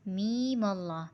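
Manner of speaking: میم اللہ with میم run straight into اللہ, slow; joined letters. Shown as a demonstration. Teacher showing pupils how میم joins اللہ. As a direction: blend میم into اللہ without a break. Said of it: "میم کو پورا نہیں پڑھنا ہے".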